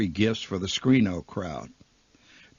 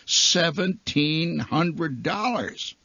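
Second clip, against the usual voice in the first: very slow, higher than usual; awed, drawn out; 5 semitones higher, much slower than usual. Acted. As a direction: dwell dreamily on every word of the sum. Marquee figure haunting the dreamers.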